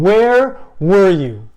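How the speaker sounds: a grieving sister accusing Jesus, loud and raw; anguished accusation. Acted. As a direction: hurl the question at him through tears. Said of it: "And I'm not even doing it justice".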